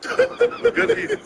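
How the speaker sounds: high, slow words through real laughter; laughing. Real, not acted. Friends joking about his weight gain, laughing. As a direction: crack up while saying it. Both laughing at good eating joke.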